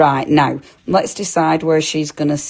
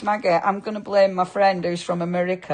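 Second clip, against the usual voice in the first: ordinary delivery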